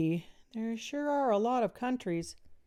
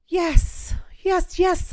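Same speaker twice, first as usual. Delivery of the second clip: much higher and louder, bursting with eagerness; eager yes; 12 semitones higher, 10 dB louder, much slower than usual. A narrator's reading. Melody answering Dad, bursting with eagerness. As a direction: jump at the chance.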